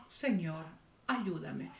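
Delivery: soft, low voicing of a humble plea; hushed imitation of a begging woman; tearful plea, understated. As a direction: plead quietly, as if near tears. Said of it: "estaba con lágrimas en los ojos".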